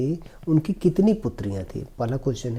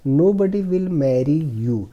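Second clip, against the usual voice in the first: slow, careful reading of the English passage; slow reading aloud; 3 semitones higher, 4 dB louder, much slower than usual. Shown as a demonstration. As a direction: read each word slowly for the class. Teacher reads story slowly. (धीमी आवाज़ में अंग्रेज़ी पढ़ना)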